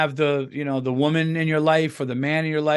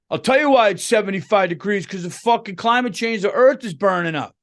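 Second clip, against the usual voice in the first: mock climate ranter; 6 semitones higher, 4 dB louder than usual. Acted climate argument.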